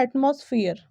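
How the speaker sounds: एटमॉस्फेयर with a full "moss" vowel; common mispronunciation. Shown as a demonstration. Teacher showing what many people say, wrong pronunciation. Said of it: "जो कि बिल्कुल गलत है".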